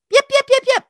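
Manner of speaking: high-pitched rapid yips; little dog barking. Retold or imitated. Storyteller imitating a dog by her car, high yapping. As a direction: yap like an excitable little dog.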